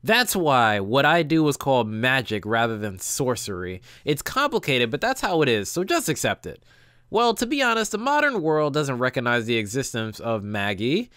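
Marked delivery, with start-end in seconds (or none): none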